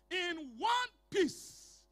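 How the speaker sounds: ordinary talk, nothing marked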